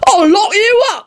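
high-pitched outraged threat; squawking constable's bawl. A narrator's reading. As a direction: bawl it as a flustered officer, voice high. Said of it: "bawled the constable".

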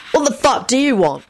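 high, irritated snap; high-pitched annoyed challenge; rude snap. A narrator's reading. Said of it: "William snapped rudely".

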